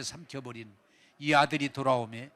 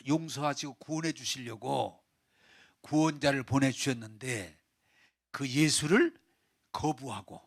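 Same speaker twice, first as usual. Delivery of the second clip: ordinary talk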